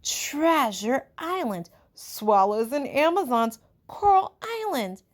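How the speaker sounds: put-on high, loud boys shouting book titles; excited clamor. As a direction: call out over each other eagerly. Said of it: "there was a clamor".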